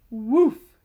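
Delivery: loud short bark; yap. A narrator's reading. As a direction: yap like a little dog. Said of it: "yapped the K nine".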